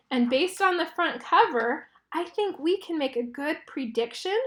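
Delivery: ordinary delivery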